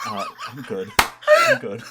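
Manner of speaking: put-on high, loud South African accent. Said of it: "I almost instinctively went into the South African accent".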